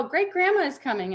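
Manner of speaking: imitated toddler announcing a visitor, pitch jumping up; two-year-old. Toddler tells mother who is coming, imitated child voice. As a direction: mimic a small child calling Mama. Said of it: "my two-year-old, goes".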